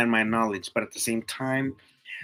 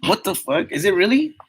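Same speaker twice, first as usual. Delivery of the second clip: higher, louder, incredulous; stunned disbelief; 8 semitones higher, 6 dB louder than usual. Real, not acted. Streamer reacting to the comment, high loud disbelief. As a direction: hit him with genuine disbelief.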